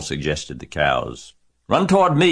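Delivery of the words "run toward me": very high, loud yell; gander yelling. A narrator's reading. Gander urges fleeing Wilbur his way, yelling. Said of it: "yelled the gander".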